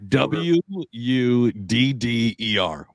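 slowly spelling out W U D D E R letter by letter. Shown as a demonstration. Host spells the Jersey way of saying water, slowly. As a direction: spell out each letter of W U D D E R deliberately. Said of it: "they say wooder".

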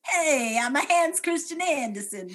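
high, loud, bad Danish accent; wildly miss a Danish accent; badly faked Danish accent, high and loud. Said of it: "miss an accent by such a wide margin".

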